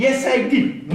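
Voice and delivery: tit-for-tat as the wife; bickering wife. Acted.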